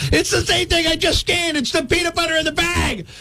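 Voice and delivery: high, strained comic indignation; exasperated protest. Acted. Customer protesting to self-checkout, exasperated. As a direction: plead your case in a high, indignant voice.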